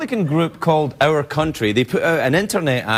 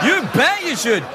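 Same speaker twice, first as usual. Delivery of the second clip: emphatic shout; 10 semitones higher than usual. Real, not acted.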